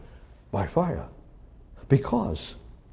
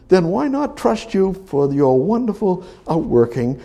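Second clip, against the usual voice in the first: high, loud pulpit preaching; high-pitched impassioned sermon voice; fervent preaching; 10 semitones higher, 5 dB louder than usual. Acted. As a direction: preach it hard to the congregation. Preaching with raised voice.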